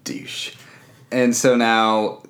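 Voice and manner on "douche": whispered under breath; whispered insult. Retold or imitated. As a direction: hiss the insult softly. Insult tacked on.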